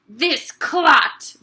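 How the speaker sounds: booming insult; boomed. Acted. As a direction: denounce him to the school. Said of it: "boomed the headmistress".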